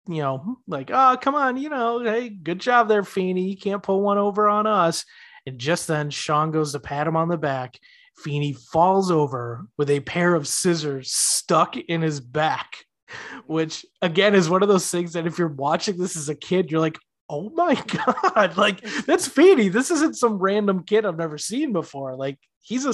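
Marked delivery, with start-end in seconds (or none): 0.9-5.0 higher, teasing scoff; mock scoffing. Mocking the killer Feeney. 17.3-22.2 much higher, laughing shocked gasp; mock shock. Kid shocked by Feeney's death.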